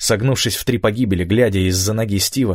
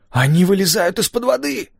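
much higher, amazed exclamation; astonished; 12 semitones higher than usual. A narrator's reading. Gabby sees them rise from the sea, astonished. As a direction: blurt it out high in disbelief. Astonished lookout spots submarine surfacing.

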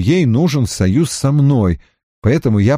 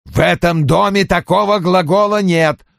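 raised pitch, final verdict; categorical overruling; 7 semitones higher than usual. Acted. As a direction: lay down the house rule. Boss overrules the objection. (категорично, не допуская возражений)

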